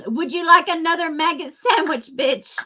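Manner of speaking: high mocking sneer of a jailer; mocking server voice. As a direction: sneer it like a guard serving slop. Mock offer to Maxwell.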